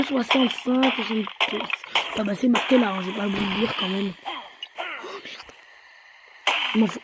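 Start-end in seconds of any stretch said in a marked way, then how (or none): 4.8-5.5 soft swear under the breath; whispered curse. Real, not acted. Gamer reacting to his own slip, whispered curse. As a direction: whisper it as a private oops.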